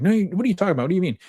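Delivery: high-pitched feigned innocence; mock-defensive protest. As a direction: protest high, as if wrongly accused. Accused enabler protests innocence.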